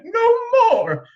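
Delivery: very high, loud shriek; screamed. A narrator's reading. Queen fed up with the magician, shrieking. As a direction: cry it out in outrage. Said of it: "the queen screamed".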